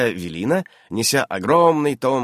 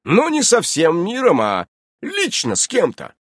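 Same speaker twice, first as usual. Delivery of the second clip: loud, high self-justification; raised, emphatic defensive voice; offended Innokenty; 8 semitones higher, 6 dB louder than usual. A narrator's reading. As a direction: insist on your abilities with wounded pride.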